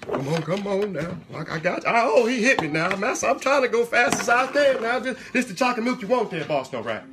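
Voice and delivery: hammed-up Southern servant, stammering and fretting; flustered subservient servant. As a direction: plead with the boss in mock fluster. Servant frets to boss and audience.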